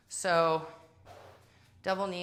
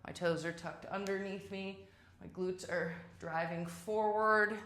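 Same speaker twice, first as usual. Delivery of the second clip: ordinary talk, nothing marked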